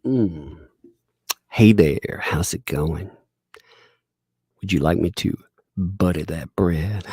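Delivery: low, slow seductive purr; hammed-up flirty come-on; sexy voice. Shown as a demonstration. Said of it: "Can you do sexy voice?".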